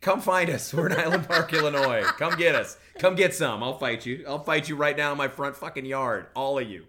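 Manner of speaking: loud, high mock-fighting taunt; mock challenge. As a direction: dare them loudly, half laughing.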